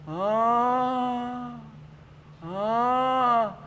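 long, high moan; drawn-out "ah" of an injured man; acted moan. Acts injured man moaning by pole.

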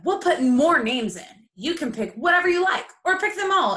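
put-on high, loud excited boy; quick and eager. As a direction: rush it out eagerly. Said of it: "Ralph quickly said".

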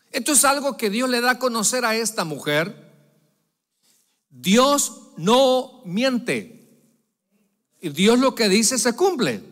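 high, loud fervent preaching; preaching at full force. Acted. Pastor declaring God's faithfulness to the congregation, loud and fervent.

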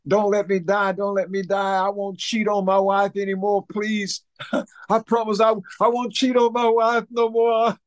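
high-pitched pleading, imitating a terrified man; panicked praying. Storyteller mimics the guitar player praying, frantic pleading. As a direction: beg God in a mock-desperate high voice. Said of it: "he's praying to God".

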